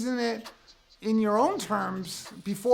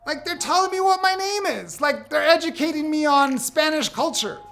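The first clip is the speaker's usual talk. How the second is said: high, louder indignant rant; incredulous; 7 semitones higher, 4 dB louder than usual. Real, not acted. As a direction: voice climbing in disbelief. Incredulous rant about being corrected.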